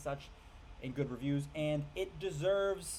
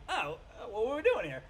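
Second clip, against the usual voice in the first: higher, bewildered sheep, louder at the end; mock waking up with no memory; confused sheep; 8 semitones higher, 3 dB louder than usual. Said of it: "and they're like".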